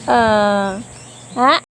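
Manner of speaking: usual speaking voice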